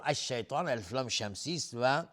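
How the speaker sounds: ordinary delivery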